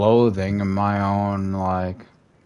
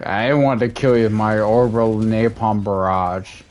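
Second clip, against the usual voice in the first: ordinary talk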